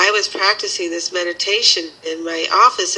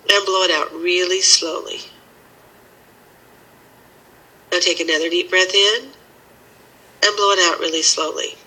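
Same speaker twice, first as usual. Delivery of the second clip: guided meditation. Acted. Leads a breathing exercise.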